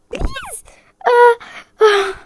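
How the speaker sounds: squeaky high begging, then crying out; real frightened whimper; desperate plea. Real, not acted. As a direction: plead in terror as you are caught. Begging as chef catches her.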